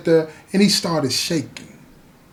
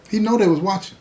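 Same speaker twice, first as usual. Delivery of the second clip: ordinary talk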